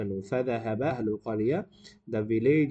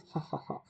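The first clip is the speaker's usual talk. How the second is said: soft acted ha-ha-ha; a short staccato prankster's chuckle; shepherd's mock laugh; 2 semitones higher, 9 dB quieter than usual. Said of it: "He started laughing".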